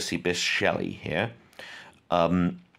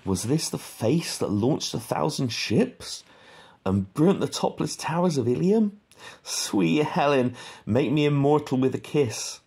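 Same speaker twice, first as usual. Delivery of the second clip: dramatic reading; 7 semitones higher than usual. Shown as a demonstration. Teacher declaiming Faustus's speech to Helen, grand and theatrical. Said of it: "We'll just read a little bit of it".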